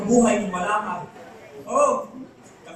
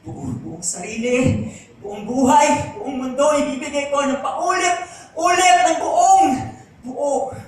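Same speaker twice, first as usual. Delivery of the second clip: slow build to a high shout; impassioned vow; 6 semitones higher, 7 dB louder, slower than usual. Acted. Vowing to give a whole self again, impassioned. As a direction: build each repeat louder and higher, drawn out.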